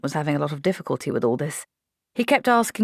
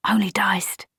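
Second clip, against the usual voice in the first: whisper; 4 semitones higher, slower than usual. Acted. Grim joke about the grill.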